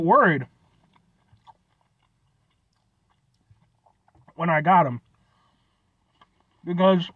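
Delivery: eating between words; talking while chewing. Real, not acted. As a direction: mouth partly full of food. Seen 2: holds empanada piece, takes bites, jaw chewing between phrases.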